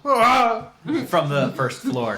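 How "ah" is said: short, loud, high "ah"; acted cry of pain. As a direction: yelp as if thrown into a wall. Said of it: "you would hear just a couple of".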